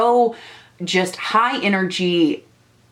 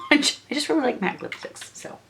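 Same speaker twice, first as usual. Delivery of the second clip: giggly whisper; 6 semitones higher, 6 dB quieter, faster than usual. Real, not acted. Giggly aside after shade denial.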